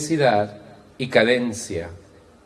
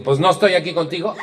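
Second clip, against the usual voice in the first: gruff husband; 7 semitones higher, faster than usual. Retold or imitated. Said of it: "Y él le dijo".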